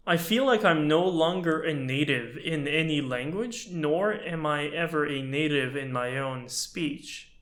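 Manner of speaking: ordinary talk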